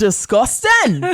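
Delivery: disgusted. Real, not acted. Mock disgust at the character.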